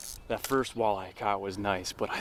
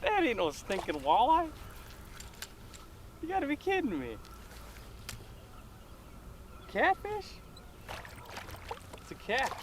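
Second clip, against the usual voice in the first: much higher, squeaky disbelief; can't believe it's a catfish; incredulous; 5 dB quieter than usual. Real, not acted. Caught a catfish, not walleye.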